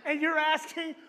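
high, loud, laughing disbelief; incredulous disciples. Retold or imitated. Disciples laughing at Jesus in the crowd, high incredulous voice. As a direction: laugh it off as an absurd question.

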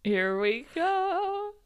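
very slow, stretched words; drawn-out. Pretend. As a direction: drag each word out.

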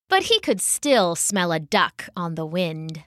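ordinary delivery

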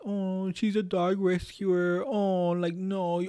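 a high, slow mock-sympathetic voice for the sympathy, then dropping into the scoff. Coworker mocking the customer's sympathy-seeking post, in a high mock-sympathetic voice. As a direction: fake pity that is mocked as it goes. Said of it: "She wants people to be like".

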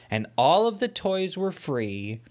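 ordinary delivery, nothing marked

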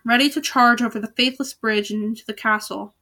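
ordinary delivery, nothing marked

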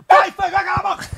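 sudden high shout, half laughing; shouting at the dog. Real, not acted. Host yelling at the dog on his lap. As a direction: snap at the restless dog, laughing.

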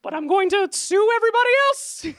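high, loud Mickey-style squeal; corporate villain gloating. Acted. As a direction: gloat in a shrill mouse voice. GM voicing Mickey threatening.

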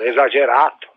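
ordinary talk, nothing marked

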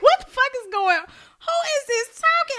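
high-pitched mock bewilderment; retold disbelief. Co-host recalling hearing the interview, high-pitched disbelief. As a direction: squeal your baffled reaction to the voice. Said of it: "I can't take him serious".

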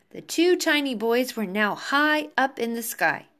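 ordinary delivery

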